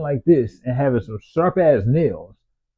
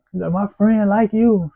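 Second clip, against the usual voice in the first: mock girl's voice; 9 semitones higher, slower than usual. Girl voiced in story.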